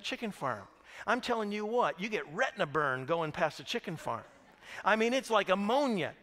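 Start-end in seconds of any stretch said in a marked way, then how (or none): none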